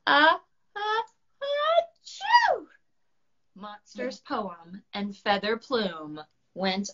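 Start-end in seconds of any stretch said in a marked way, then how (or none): 0.1-1.0 rising, louder gasping uh uh before a sneeze; sharp breaths before a big sneeze; sneeze build-up. Acted. Dragon winding up to sneeze. 1.8-2.7 loud high cartoon achoo; dragon's explosive sneeze; big sneeze.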